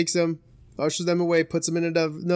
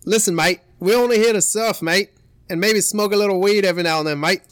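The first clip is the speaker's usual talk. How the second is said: loud Aussie surfer; Australian accent; 4 semitones higher, 5 dB louder than usual. Acted. Australian surfer explaining they're only visiting, loud Australian accent. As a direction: plead the case in an Australian accent. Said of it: "Negative light".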